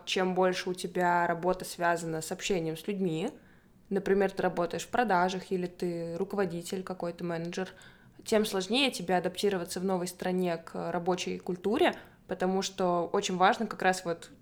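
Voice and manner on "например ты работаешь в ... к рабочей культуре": ordinary talk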